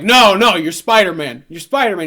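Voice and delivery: loud, emphatic imitation; insistent announcer. Announcer overruling Peter's chosen name, loud and insistent.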